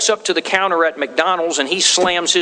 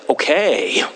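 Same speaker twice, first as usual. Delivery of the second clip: annoyed okay; 3 semitones lower than usual. Shown as a demonstration. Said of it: "it's the tone of voice, the same words".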